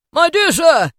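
big, hearty acted exclamation; effusive greeting. A narrator's reading. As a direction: hail him loudly, voice pitched high. Scrooge hails the old gentleman.

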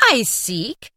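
spirit's startled exclamation; astonished spirit exclaiming; exclaimed. Acted. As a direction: blurt it in disbelief. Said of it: "exclaimed the spirit".

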